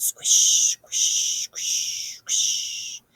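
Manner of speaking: whispered wet squish; squishing leaves. A narrator's reading. Narrator voicing wet leaves underfoot, whispered squish. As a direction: breathe the squelch of leaves. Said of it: "That was a scarier sound".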